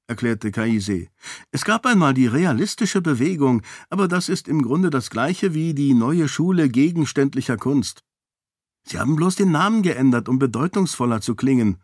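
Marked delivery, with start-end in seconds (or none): 1.5-11.8 put-on higher, eager know-it-all child lecturing the adults; precocious little girl. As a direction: rattle it off like a proud little expert. Voicing the little girl Kaise.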